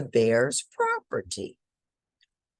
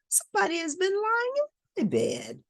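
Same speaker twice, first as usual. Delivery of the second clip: higher, alarmed mother bear; Mama Bear crying out. Acted. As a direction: cry it out like a shocked mama bear. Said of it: "cried Mama Bear".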